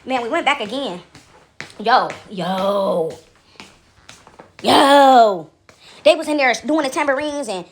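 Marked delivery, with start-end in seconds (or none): 1.8-5.5 long drawn-out exclamations, building from low to loud; stunned disbelief. Real, not acted. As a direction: let the shock swell after each pause. Stunned reaction before describing church scene.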